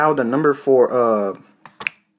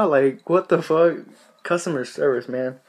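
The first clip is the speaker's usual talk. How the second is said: ordinary talk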